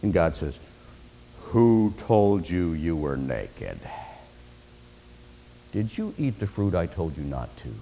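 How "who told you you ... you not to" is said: very low, soft and deliberate; God questioning gravely. Acted. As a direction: confront quietly, drawing it out. God confronts Adam.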